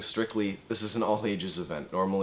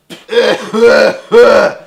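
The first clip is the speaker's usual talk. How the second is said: imitated fart noise; 14 semitones higher, 21 dB louder than usual. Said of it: "cuts a fart".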